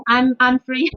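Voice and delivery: usual speaking voice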